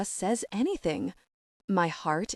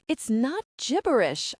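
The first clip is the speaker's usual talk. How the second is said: a bit higher, insisted with offended pride; indignant; 6 semitones higher than usual. A narrator's reading. Becky protests to Luke about her message, indignant. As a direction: protest as if insulted. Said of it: "I replied indignantly".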